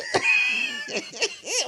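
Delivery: high wheezy laughing fit; helpless high-pitched cackle; wheezing laugh. Real, not acted. Pitcher collapsing into high wheezing laughter.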